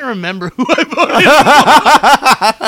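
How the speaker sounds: imitating a sheepish laugh through the words; slow, high and loud, laughing it off; embarrassed laugh. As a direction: caught out, giggling. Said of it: "he had an embarrassed moment".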